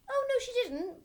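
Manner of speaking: put-on piping little boy. Boy correcting the headmistress, high and piping. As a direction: pipe it out like an eager schoolboy. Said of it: "piped Nigel".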